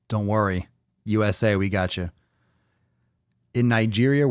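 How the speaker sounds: normal talking voice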